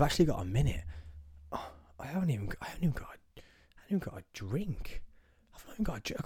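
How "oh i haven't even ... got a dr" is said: whispering. Real, not acted.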